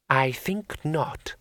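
very slow, cool refusal; commanding woman. Acted. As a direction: draw out the refusal imperiously. Dragon woman refuses the hero.

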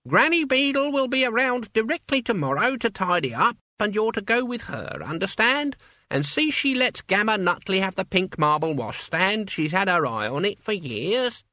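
put-on high, crackly old woman; old witch voice. A narrator's reading. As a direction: play an elderly witch, brisk. Witch voice in narration.

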